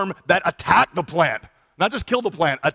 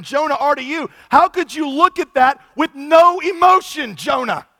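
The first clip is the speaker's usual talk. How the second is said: pleading God; 8 semitones higher, 6 dB louder than usual. Retold or imitated. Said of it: "It's like".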